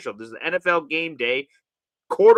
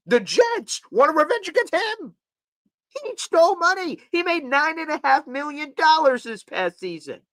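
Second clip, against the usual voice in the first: higher, indignant rant; indignant outburst at a higher pitch; outraged; 8 semitones higher than usual. Real, not acted. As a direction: fire back in outrage.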